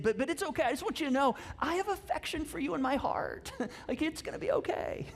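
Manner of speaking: high-pitched, sugary reassurance, hammed-up; mock soothing spouse. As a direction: let them down gently in a high imitation voice. Acts wife breaking awkward news gently.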